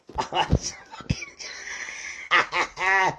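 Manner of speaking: breathy unvoiced chuckle; whispered laugh. Real, not acted. Streamer laughing at his phone-sex joke, whispered chuckle.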